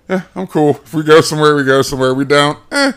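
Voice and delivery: lightly voiced friend turning down plans; mock laid-back friend. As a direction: play him shrugging everything off, light and high. Imitating friend's laid-back refusal.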